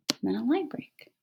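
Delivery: whispered aside to the class. Shown as a demonstration. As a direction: drop to a whisper on the line break. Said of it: "I've just whispered pause".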